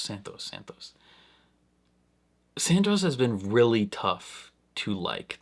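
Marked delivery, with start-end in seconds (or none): 0.0-0.9 breathy whisper, name said twice. Pretend. Reviewer bracing to talk about Santos, whispering. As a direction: whisper the name like a dreaded topic. Stalling before tackling Santos.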